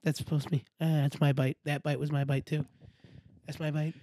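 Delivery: low, subdued imitation of a muttered complaint; lightly voiced low grumbler; homeless guy grumbling. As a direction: quietly grumble under the breath. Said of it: "he was going".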